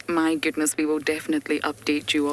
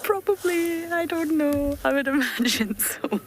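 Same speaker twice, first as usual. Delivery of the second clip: high-pitched reply through giggles; giggling; 10 semitones higher than usual. Real, not acted. Joking with viewers about the sleeping lion, giggling. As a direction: let real laughter push the voice up high.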